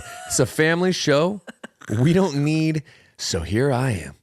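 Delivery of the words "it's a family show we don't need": mock outrage. Mock scolding cohost's joke.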